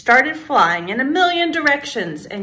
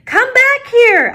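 high, loud yell after a runaway; 13 semitones higher, 8 dB louder than usual. A narrator's reading. Girl to her runaway wand, yelling. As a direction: call it back loudly, voice pitched way up. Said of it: "I yelled".

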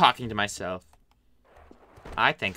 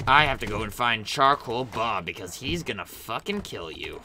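ordinary talk

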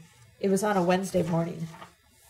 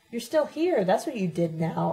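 plain reading voice

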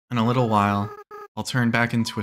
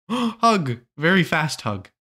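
eager hug; 6 semitones higher, slower than usual. Real, not acted.

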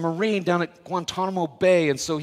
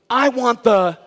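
movie lawyer; 6 semitones higher, 8 dB louder, slower than usual. Retold or imitated. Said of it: "He looks back and he goes".

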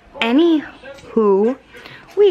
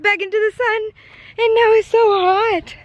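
exasperated; 13 semitones higher, 3 dB louder than usual. Real, not acted. Complaining about heat.

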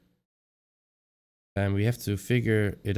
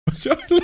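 high laugh; 4 dB louder than usual. Real, not acted. Laughs after solving puzzle.

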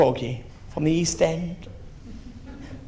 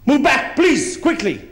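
raised, urgent driver's order; bossy Dutch driver; 6 semitones higher than usual. Retold or imitated. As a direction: bark it like a pushy chauffeur. Dutch driver ordering car back.

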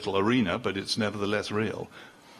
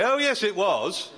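high, slow, over-the-top oh yes it was; mock gushing reassurance; 9 semitones higher, 3 dB louder than usual. Fawning churchgoer soothing a vicar fishing for praise, high and slow. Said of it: "Begging you to say".

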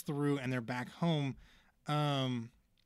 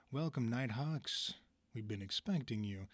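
menacing host voice; 4 dB quieter than usual. Retold or imitated. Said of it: "and Korvax goes".

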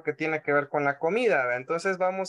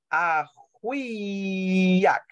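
imitating slow syllables. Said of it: "diga ajuiak despacito".

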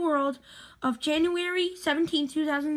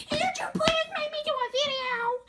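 put-on high-pitched pleading kid voice; begging Alex; 12 semitones higher than usual. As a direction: beg in a put-on high little voice. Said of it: "Alex one day went like".

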